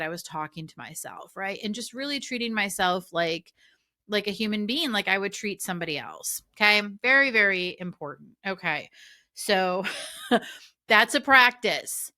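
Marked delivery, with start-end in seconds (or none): none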